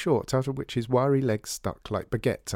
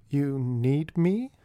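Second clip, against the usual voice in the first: slow, wary question; suspicious; 4 semitones higher, much slower than usual. Acted. George Joseph asking Artie why, suspicious and slow. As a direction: draw it out with distrust. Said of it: "George Joseph sounded suspicious".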